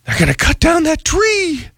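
high, aghast mock alarm; shocked neighbors. Neighbors hearing the city will cut the tree, mock alarmed. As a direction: squeal it out like scandalized neighbors. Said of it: "everyone in the neighborhood went".

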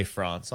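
France said softly as "Frahnce"; cultured pronunciation. Shown as a demonstration. Co-host on cultured people, affected "Frahnce". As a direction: say France with a long, soft "ah". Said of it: "If you're really cultured".

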